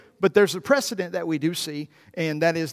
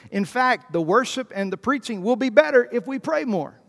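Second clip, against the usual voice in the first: usual speaking voice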